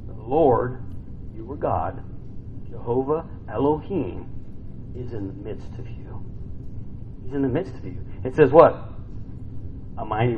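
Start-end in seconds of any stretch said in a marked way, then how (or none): none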